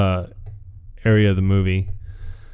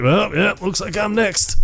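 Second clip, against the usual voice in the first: smug Beetlejuice; 11 semitones higher than usual. Retold or imitated. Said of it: "And he's like".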